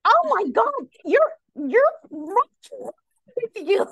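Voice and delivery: high, slow, starstruck gush; imitating a fan gushing at the speaker; mock gushing woman. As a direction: swoon over him, hammed up. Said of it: "this woman stands up ... and says".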